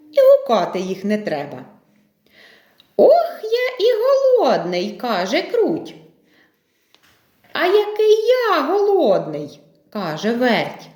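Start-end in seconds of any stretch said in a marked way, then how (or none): 3.0-4.9 high-pitched mouse whine of hunger; hungry little mouse. Acted. Krut comes to the table, hungry mouse voice. 7.5-9.6 high mouse voice claiming hunger; hungry little mouse. Acted. Vert echoes Krut at table, hungry mouse voice.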